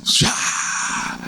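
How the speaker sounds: breathy swoosh sound for a dramatic fall; whooshing throw-down noise. Retold or imitated. Preacher mimics Mary dropping to the ground, breathy swoosh. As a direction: voice the body hitting the floor. Throws herself at Jesus' feet.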